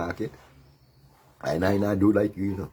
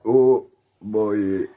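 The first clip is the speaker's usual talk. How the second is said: ordinary delivery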